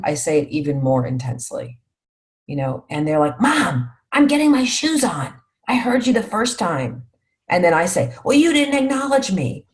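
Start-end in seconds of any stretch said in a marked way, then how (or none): 3.4-7.1 put-on exasperated kid. Kids retort to nagging mom. 8.2-9.7 indignant mom. Retold or imitated. Mom escalates at her kids.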